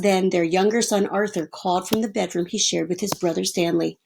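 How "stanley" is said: plain reading voice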